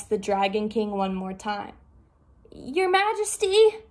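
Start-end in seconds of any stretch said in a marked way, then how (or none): 2.5-3.8 high, slow plea; pleading rabbit. Acted. Rabbit bravely calling to the Dragon King, high. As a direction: call out to the king, voice raised high.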